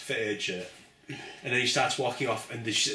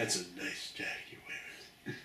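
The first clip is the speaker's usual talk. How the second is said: menacing whisper; 8 dB quieter than usual. Acted. Menacing Big Marv line.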